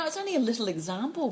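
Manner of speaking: usual speaking voice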